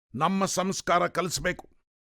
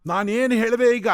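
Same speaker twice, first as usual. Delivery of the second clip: louder, higher, defensive protest; higher and louder indignant question; indignant outburst; 6 semitones higher, 6 dB louder than usual. Acted. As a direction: snap back, stung by the accusation. Swami defends himself, indignant.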